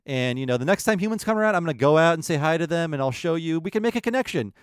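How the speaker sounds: sweetly trusting, sure humans are friends; naive cheerful fawn. Retold or imitated. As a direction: Gobo gushing to the herd about kind humans. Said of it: "And Gobo's like".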